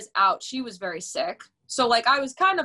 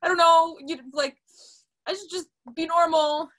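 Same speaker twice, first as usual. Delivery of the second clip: frantic rush; 8 semitones higher, 3 dB louder, faster than usual. Retold or imitated. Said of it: "I'm, like, hysterical crying".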